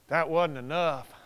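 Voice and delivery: usual speaking voice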